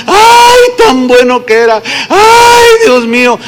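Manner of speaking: high, hammed-up fake lament; mock wailing mourner. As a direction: wail like a paid mourner. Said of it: "se paraban ahí junto al féretro y empezaban". (lamento fingido de plañidera, agudo y exagerado)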